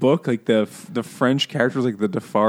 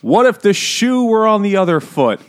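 higher, louder mock earnest plea; hammed-up pleading; 10 semitones higher, 7 dB louder than usual. Doctor Manette urging the court, hammed-up pleading. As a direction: plead it big and theatrical.

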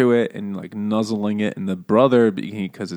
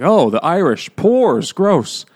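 much higher, louder sneering disgust; snobbish disgust; 8 semitones higher, 8 dB louder, slower than usual. Retold or imitated. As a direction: sneer like a snob at something beneath you. Snobbish Mertons sneering at potato.

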